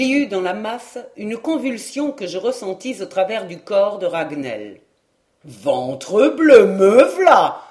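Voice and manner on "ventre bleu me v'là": rough shout. Acted. Rough lad bursting into the crush.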